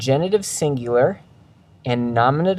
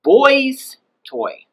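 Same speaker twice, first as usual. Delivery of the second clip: put-on "boy's" held high and loud, "toy" dropping; exaggerated high "boy's" for possession; pitch way up on boy's; 12 semitones higher, 5 dB louder than usual. Shown as a demonstration. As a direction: send "boy's" right up, then let "toy" fall. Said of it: "Did you hear my voice go up?".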